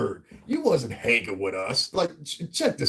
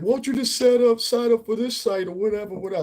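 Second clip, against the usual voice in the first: much higher-pitched mock female voice; imitated woman nagging; 9 semitones higher than usual. Woman urging channel owner to sign up, imitated nagging. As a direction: imitate her pushy suggestion in a raised pitch. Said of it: "there was this one chick used to be on my channel".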